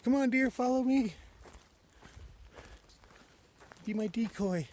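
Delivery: high, loud singsong call; high-pitched playful calling; calling the deer. Pretend. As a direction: coax an animal along in a high voice. Calls to the deer to follow.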